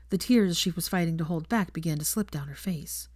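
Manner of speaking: usual speaking voice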